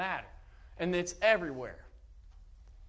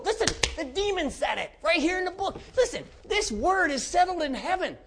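high, loud pulpit thunder, slowing at the end; fiery preaching; 12 semitones higher, 5 dB louder than usual. Acted. Preacher warning his congregation, loud and fiery. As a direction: hammer it home from the pulpit.

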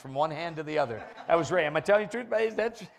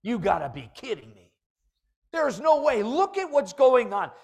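louder, higher protest, half acted; mock indignant objection; 5 semitones higher, 9 dB louder than usual. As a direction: act out a listener's outraged pushback. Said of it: "Somebody says".

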